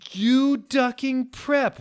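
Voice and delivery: high, slow furious shriek; drawn-out outraged yell; angry yell. Acted. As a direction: scream it at the traitor. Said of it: "we all yielded angrily".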